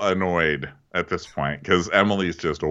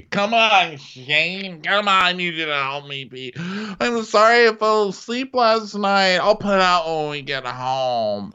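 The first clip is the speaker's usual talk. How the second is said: put-on high, whiny drunken plea; drunk Emily; 10 semitones higher, slower than usual. Drunk wife begging her husband, high whine.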